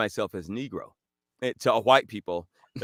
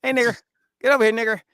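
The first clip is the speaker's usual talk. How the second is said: higher-pitched mock call to a dog; 10 semitones higher, 3 dB louder, faster than usual. Imitating dam workers calling their dog, mock calling out. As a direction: call the dog over from afar.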